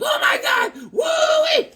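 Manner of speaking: very high, loud squealing shout; mock thrilled scream. Guy sliding down the hill, hammed-up high scream. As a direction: shriek like you're sliding downhill.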